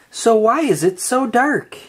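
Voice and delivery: usual speaking voice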